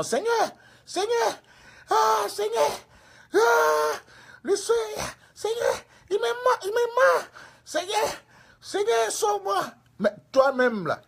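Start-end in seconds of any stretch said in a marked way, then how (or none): 0.0-9.6 very high, hammed-up shrieks; panicked pleading. Evangelist with bitten finger crying to the Lord, mock screams. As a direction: wail as if being bitten. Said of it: "L'évangile maintenant dit".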